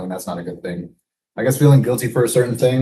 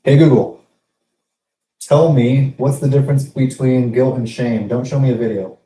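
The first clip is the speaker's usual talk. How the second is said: repeating to voice assistant; 4 semitones higher, 7 dB louder than usual. Real, not acted. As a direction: say it again louder for the assistant. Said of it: "Ah, fuck".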